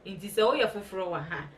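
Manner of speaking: ordinary talk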